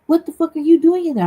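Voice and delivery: exasperated outburst. Retold or imitated. Angry quote at sisters online.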